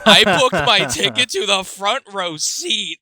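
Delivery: laughing. Real, not acted. Laughing at own booking mistake.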